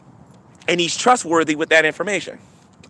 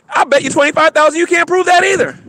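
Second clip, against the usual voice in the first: usual speaking voice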